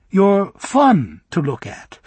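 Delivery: put-on light, lilting girlish voice; coy and teasing, fishing for words. Galinda teasing Bock.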